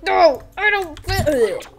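stretched-out mock distress; strained protest. Arctic figure refusing, high drawn-out protest.

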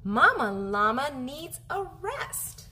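usual speaking voice

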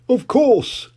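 high jolly reply; Daddy Pig. Acted. Daddy Pig reassuring Peppa, high and jolly. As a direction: answer warmly like a cheery dad. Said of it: "he said".

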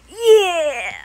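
loud, falling drawn-out yell; play cheer. Pretend. Number character cheering in play, yelling. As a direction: yell it out long.

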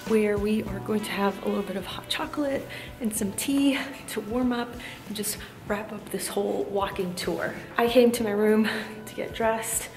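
ordinary talk, nothing marked

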